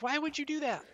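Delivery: a high, squeaky what-were-you-thinking; incredulous disbelief. Real, not acted. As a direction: pitch shoots way up in disbelief. Incredulous rant about the stunt.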